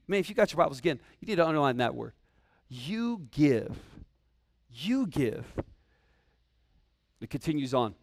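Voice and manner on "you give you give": "You give" low and soft, each time set apart by pauses; emphatic repetition. Shown as a demonstration. Preacher telling listeners to underline "You give", deliberate emphasis. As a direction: let "You give" land slowly and weightily, YOU swooping up then falling.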